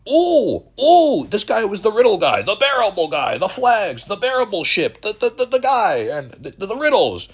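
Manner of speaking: squeaky parody of an online crypto hype man; mocking impression. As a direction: ham up his hype in a squeaky voice. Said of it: "this motherfucker for the last seventeen years".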